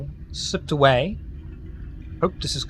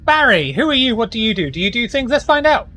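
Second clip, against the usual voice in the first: higher, bright host patter introducing the player; game show host intro; 7 semitones higher, 4 dB louder, much faster than usual. Acted. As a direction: hype up each guest like a show host. GM's host intro again.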